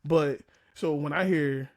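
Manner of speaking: normal talking voice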